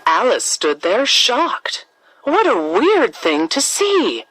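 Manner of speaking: ordinary delivery, nothing marked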